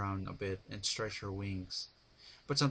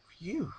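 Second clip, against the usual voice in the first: relieved sigh; 10 semitones higher than usual. A narrator's reading. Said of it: "Twilight sighed".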